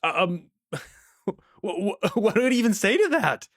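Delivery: can't believe what he just read, stammering then exclaiming; flabbergasted laugh that rises into an outburst; stunned disbelief. Real, not acted. Reacting to the RCMP coaching cost.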